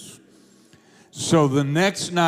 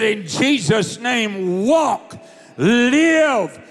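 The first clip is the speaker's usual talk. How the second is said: commanding shout; 5 semitones higher, much slower than usual. Retold or imitated. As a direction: order her to her feet. Commands dying woman to rise.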